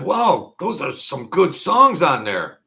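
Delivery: high, loud excited exclamation; a loud, drawn-out wow. Retold or imitated. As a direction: gush over the songs. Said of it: "I said".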